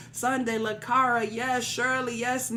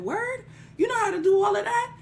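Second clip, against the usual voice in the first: high, doubtful voice asking quickly; a crowd member scoffing in surprise; surprised crowd; 10 semitones higher, 3 dB louder than usual. Acted. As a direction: sound surprised and a bit doubtful. Crowd questions Oshosi's claim.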